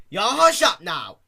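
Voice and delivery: loud hushing shout. Acted. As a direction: snap at the crying foal, loudly. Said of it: "she shouted at the foal".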